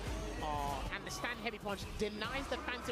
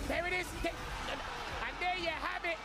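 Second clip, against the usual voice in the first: excited call; 9 semitones higher, slower than usual. Real, not acted. Caster reacts to a finishing hit.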